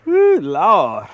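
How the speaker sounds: loud, real whoop of relief; excited whoop. Real, not acted. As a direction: let out a happy holler. After landing the fish.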